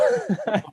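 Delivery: squealing burst of laughter; very high laugh. Real, not acted. Retelling a meditation mishap to friends, high squeal of laughter. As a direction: let the laugh fly up high.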